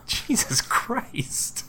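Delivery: drawn-out, voiceless disbelief; stunned breath of dismay; appalled whisper. Pretend. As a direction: whisper it slowly, aghast. Reacts to being told he was roofied.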